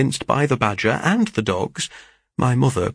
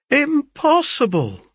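high, drawn-out exclamation of disbelief; slow, high-pitched motherly astonishment; astonished cry; 4 dB louder, slower than usual. A narrator's reading. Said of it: "cried my mother".

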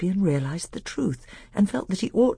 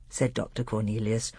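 ordinary delivery, nothing marked

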